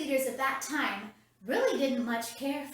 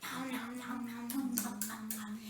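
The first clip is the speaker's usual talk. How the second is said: soft, low munching nom noms; eating noises; 5 semitones lower, 12 dB quieter than usual. Shown as a demonstration. Presenter showing the kids an eating sound, soft munching. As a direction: chomp on the syllables like a hungry kid. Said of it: "Do it with me, guys".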